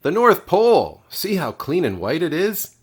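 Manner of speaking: high, loud and eager; thrilled. A narrator's reading. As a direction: burst out with joy at seeing home. Bear sights home, thrilled.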